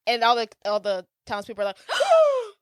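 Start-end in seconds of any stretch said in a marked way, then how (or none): 1.9-2.5 gasp. Retold or imitated. Townspeople gasp imitated.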